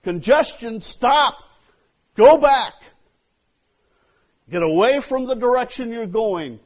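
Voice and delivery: God yelling a warning to the stubborn driver; urgent warning shout. Retold or imitated. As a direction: shout it to stop someone in their tracks. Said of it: "and God's going".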